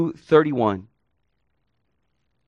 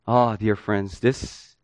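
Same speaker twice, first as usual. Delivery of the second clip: ordinary talk, nothing marked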